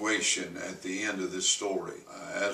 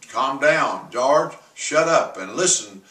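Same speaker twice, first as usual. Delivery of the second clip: loud, higher, forceful order; commanding shout; 5 semitones higher, 8 dB louder than usual. Retold or imitated. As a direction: bark the order to get through to him. Pastor commanding the raging man.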